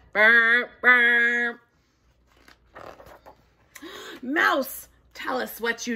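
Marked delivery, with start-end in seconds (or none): 0.1-1.6 low, loud horn noise; imitating a horn for the kids; horn sound. Shown as a demonstration. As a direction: blow it like a horn. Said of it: "Can you guys do that?".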